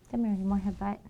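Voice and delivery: usual speaking voice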